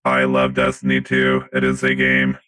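deep, monotone robot voice; robot faking human. Acted. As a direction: speak like a stiff robot pretending to be human. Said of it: "Nickotron three thousand robot".

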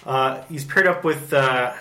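usual speaking voice

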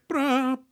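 high sung brass blare; mock grand score blast. Mocking John Williams' score over tree scene, grand brass blare. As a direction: imitate a sweeping orchestral hit. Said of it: "it's, like, very sweeping, very grand".

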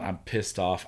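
usual speaking voice

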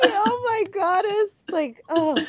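high-pitched, laughing squeal of delight; delighted gushing. Real, not acted. Host reacting to guest's life-changing work, delighted gushing. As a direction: gush high, overcome with delight.

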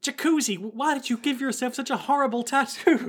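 put-on high-pitched girl's voice; dismayed girl. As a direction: ask in high mock dismay. Voicing the girl in the scene.

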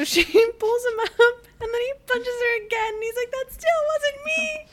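very high, squeaky laughing voice; helpless laughter. Real, not acted. Podcaster retelling a repeated punch, helplessly laughing. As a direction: squeeze the words out through laughter.

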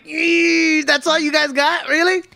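high-pitched, drawn-out mock incredulity; mock disbelief. Reader scoffing at a weak scandal story, mock disbelief. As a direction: squeal it high, unimpressed and scoffing. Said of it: "And you're like".

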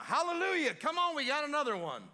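loud, high hallelujah cheer; excited shout. Retold or imitated. Preacher cheering loudly at a street healing. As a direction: shout it out like a revival.

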